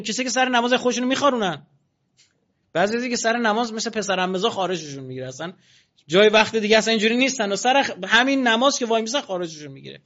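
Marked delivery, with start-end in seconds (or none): none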